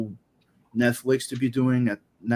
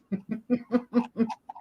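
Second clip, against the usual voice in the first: high laugh; 10 semitones higher, 5 dB quieter than usual. Real, not acted. Laughing at Netflix joke.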